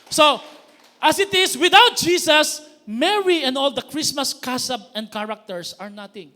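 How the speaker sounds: loud, high pulpit proclamation; forceful preaching. Acted. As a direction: drive the point home.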